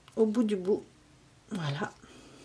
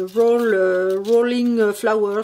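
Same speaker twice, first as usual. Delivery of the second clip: English with French accent; 8 dB louder, much slower than usual. Real, not acted. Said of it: "Désolée pour l'accent".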